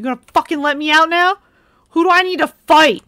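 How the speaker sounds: loud, fast, high furious shout; going ballistic. Real, not acted. Player trapped in the game, furious fast shouting. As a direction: rage at the game, ready to fight. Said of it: "Sorry I fucking went ballistic there".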